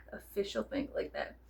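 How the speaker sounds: hushed aside; breathy half-whispered aside. Real, not acted. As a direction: drop to a whisper. Unvoiced whisper.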